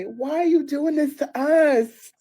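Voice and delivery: loud, high, slow mock plea; play-acted distraught girlfriend. Girlfriend playing her part on the phone, mock-distraught. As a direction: drag it out as a theatrical lament. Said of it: "I gotta play the distraught gir".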